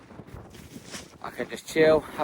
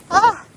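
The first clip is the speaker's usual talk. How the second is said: sudden high yelp from the cold shock; sharp gasp at cold water; 9 dB louder than usual. Real, not acted. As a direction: gasp as icy water hits. Stepping into freezing water.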